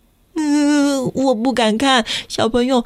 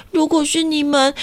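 usual speaking voice

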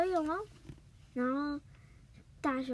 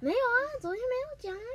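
indignant denial; 11 semitones higher, 4 dB louder, much faster than usual. Real, not acted. Protests the friend's claim.

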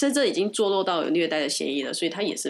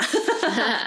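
quick high laugh; brief amused chuckle; short laugh; 6 semitones higher, 3 dB louder than usual. Real, not acted. Short laugh after a joke.

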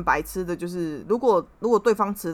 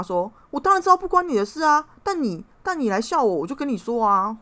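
retelling the fight, voice rising higher and louder; replaying the argument, fired up again; heated comeback; 5 semitones higher, 4 dB louder than usual. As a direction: snap back at him, indignant. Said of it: "我马上呛他说".

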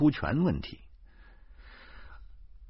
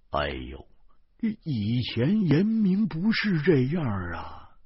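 higher, slow wounded complaint; aggrieved whine; 6 semitones higher, slower than usual. A narrator's reading. Xu complains to the police, feeling wronged. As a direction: sound hard done by. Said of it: "徐大光委屈地说".

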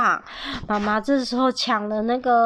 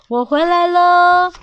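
dad's greeting; 6 semitones higher, 8 dB louder, slower than usual. Acted. Dad arrives home.

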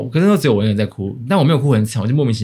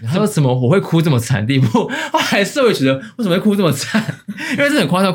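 higher, giggling through the words; laughing while telling; 9 semitones higher than usual. Real, not acted. Telling her friend about crying at the wake, laughing. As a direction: laugh at yourself as you recount it.

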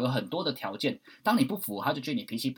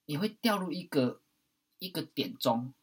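usual speaking voice

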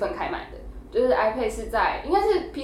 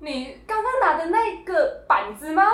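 imitating mom stammering, high and slow with laughter; a mock-stuttering high question; 8 semitones higher, slower than usual. Mimicking mom stammering at the checkout in disbelief. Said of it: "这个结巴是我妈结巴".

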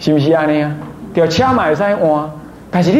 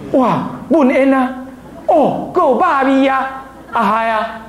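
slow, higher, hammed-up wonder at the smoke; drawn-out fake surprise at the burning smell; mock burn reaction; 6 semitones higher, slower than usual. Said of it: "你不会讲". (慢悠悠夸张地惊叹)

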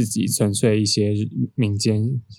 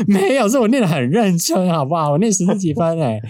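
higher, indignant rebuttal; retold annoyed protest; 8 semitones higher than usual. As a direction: push back on the mom, mildly huffy. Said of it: "我心里旁边就很生气".

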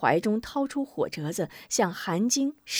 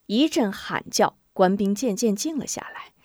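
ordinary delivery

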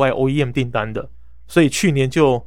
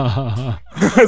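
amused laugh. Real, not acted. Laugh at his own phrase.

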